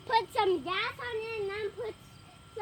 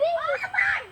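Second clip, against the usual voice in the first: higher, slow startled cry; shocked; 9 semitones higher, 6 dB louder, much slower than usual. Real, not acted. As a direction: gasp it out in shock. Startled by big spider.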